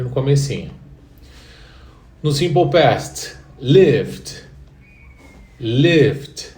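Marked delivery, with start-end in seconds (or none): 3.6-6.5 "lived" loud, stressed at the start; model pronunciation. Shown as a demonstration. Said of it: "É como se fosse um D".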